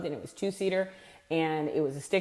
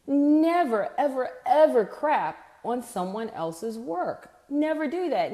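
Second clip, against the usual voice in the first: high, insistent never ever ever; emphatic warning; 7 semitones higher, slower than usual. Real, not acted. Sales coach warning the audience never to crap on work, emphatic. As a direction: hammer each never. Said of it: "emphatic sales warning".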